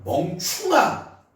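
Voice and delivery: slow drawn-out 멍충아 yell; mock shout. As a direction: bellow it slowly. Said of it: "무슨 뜻이야".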